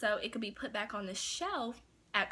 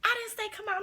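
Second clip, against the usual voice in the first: high-pitched imitated scolding yell; 12 semitones higher than usual. Manager scolding cashier for coming out, high retold yell. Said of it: "she just had yelled at me".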